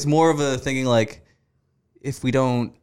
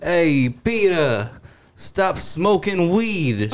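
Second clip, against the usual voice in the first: slow, higher mock dad drawl; 6 semitones higher, much slower than usual. Peter imitating his pothead dad telling him off, slow and high. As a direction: stretch it out like a stoned old dad. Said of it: "my dad's like".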